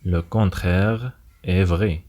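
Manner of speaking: slow, careful teacher delivery. Shown as a demonstration. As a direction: stretch each word out clearly. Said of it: "repeated slowly as a teaching demo".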